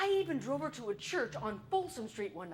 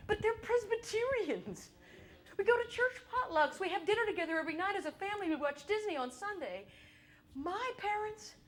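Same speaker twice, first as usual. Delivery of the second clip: incredulous; 7 semitones higher than usual. Acted. Incredulous about parents.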